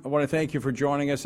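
usual speaking voice

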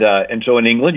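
usual speaking voice

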